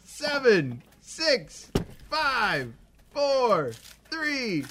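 loud, high countdown shout; new year countdown crowd. Real, not acted. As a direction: shout each number to midnight. Counting down to midnight.

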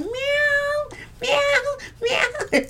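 very high teasing cat meows; playful meows. Pretend. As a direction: meow like a flirty kitten, way up high. You got Kitty in your name.

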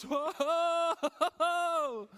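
hammed-up panic at the boss; alarmed backing off. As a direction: recoil in comic fright. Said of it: "Dat we denken".

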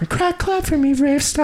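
squeaky pleading fairy; high fairy voice. Pretend. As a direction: beg high and thin.

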